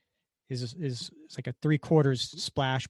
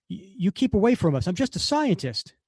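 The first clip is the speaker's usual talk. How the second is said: mock panicked plea, pitched up; scared scientist; 7 semitones higher, 4 dB louder than usual. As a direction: back away from Cap in a fright.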